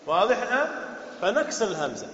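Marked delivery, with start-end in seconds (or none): none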